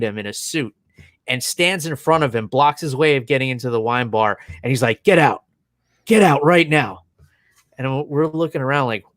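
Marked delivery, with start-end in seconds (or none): 5.0-7.0 firm, louder retold command; mildly heated, emphatic dismissal; mock angry order. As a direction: imitate an irate man ordering someone out. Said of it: "just lost his shit".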